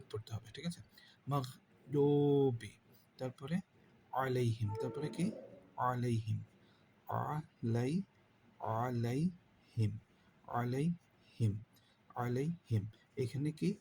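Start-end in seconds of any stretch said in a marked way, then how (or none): 1.3-2.7 slow Quranic chant; drawn-out recitation. Shown as a demonstration. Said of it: "teacher demonstrates recitation". 8.6-12.8 slow Quranic chant; drawn-out recitation. Shown as a demonstration. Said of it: "teacher demonstrates recitation".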